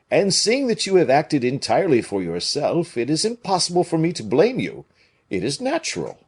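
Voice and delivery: generous musketeer forgiving; Porthos voice. A narrator's reading.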